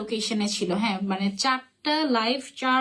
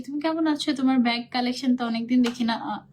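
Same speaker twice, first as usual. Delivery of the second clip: usual speaking voice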